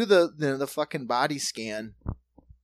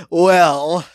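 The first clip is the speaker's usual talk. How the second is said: louder, slow, put-on prissy voice; mock prim resignation; 9 dB louder, much slower than usual. Submitting to a pat-down, mock-posh resignation. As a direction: sigh it out like a fussy aristocrat. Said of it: "I'm just like".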